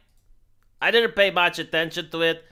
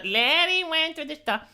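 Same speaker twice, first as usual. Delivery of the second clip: higher-pitched pupils' singsong echo; children repeating; 8 semitones higher, 3 dB quieter than usual. Acted. Children repeating after the English teacher, high mock-pupil chant. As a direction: parrot it back like a class of kids. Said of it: "Repeat after me, children".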